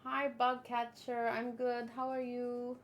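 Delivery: normal talking voice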